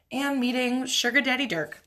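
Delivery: normal talking voice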